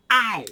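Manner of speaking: high, loud real yelp; sharp pained ow. Real, not acted. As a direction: cry out at the sting. Pinched by trigger again.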